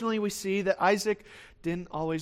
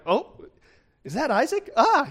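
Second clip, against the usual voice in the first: surprised gasp; 8 semitones higher, 6 dB louder than usual. Retold or imitated. Abimelech spotting Isaac at window.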